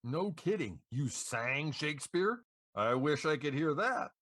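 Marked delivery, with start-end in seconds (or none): none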